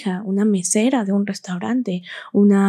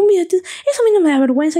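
mother's voice; 9 semitones higher, 3 dB louder, faster than usual. Retold or imitated. Mother's emphatic voiced speech.

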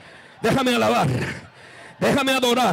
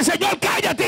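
normal talking voice

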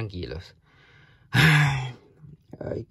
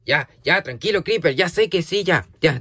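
excited; 6 semitones higher, 6 dB louder than usual. Real, not acted. Playful calming after a laugh.

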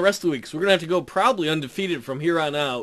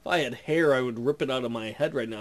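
ordinary delivery

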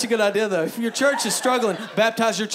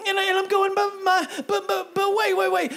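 loud, high, driving sermon delivery; full-volume pulpit preaching; preaching at full force; 11 semitones higher, 3 dB louder than usual. Acted. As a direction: preach it out to the whole room.

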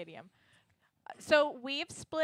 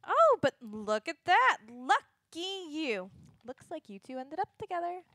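sarcastic; 3 semitones higher, 6 dB louder, slower than usual. Acted. Shayless teasing sarcastically.

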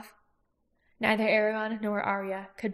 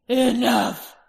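roaring; 3 semitones higher, 9 dB louder than usual. A narrator's reading. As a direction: bellow it to silence them. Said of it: "roared Glader".

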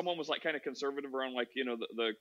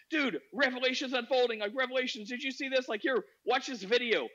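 high-pitched imitation of a zealot; breaking-news excitement; 8 semitones higher than usual. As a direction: pitch the newest theory eagerly. Acting friend's excited discovery.

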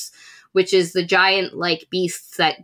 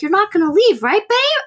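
high, pleading question rising even higher at the end; pleading wife; 11 semitones higher, 3 dB louder than usual. Retold or imitated. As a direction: coax him sweetly not to leave. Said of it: "And Harlow's like".

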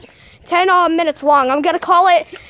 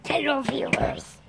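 whispering; 3 semitones lower, 8 dB quieter, slower than usual. Real, not acted. Vlogger greeting in a hush.

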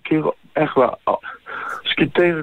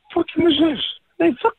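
high, indignant yelling; outraged protest; to his friend over his sister, high and indignant; angry; 12 semitones higher than usual. Real, not acted.

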